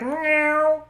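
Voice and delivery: high, sweet meow to say yes; a happy kitty meow; pleased cat meow. Pretend. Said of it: "I would only answer in meows".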